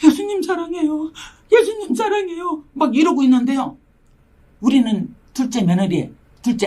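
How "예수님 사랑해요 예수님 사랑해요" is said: much higher, crying voice; crying prayer. Retold or imitated. At her prayer desk she cries to Jesus, much higher. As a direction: sob it out as a prayer. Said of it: "기도 책상 의자에 앉아갖고 막".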